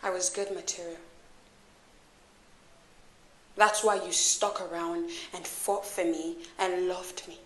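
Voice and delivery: low, soft, held-back confrontation; softly spoken, controlled hurt; quiet reproach. Acted. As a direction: keep the accusation low and quiet. Hurt confrontation, holding anger in.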